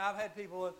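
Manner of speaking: ordinary talk, nothing marked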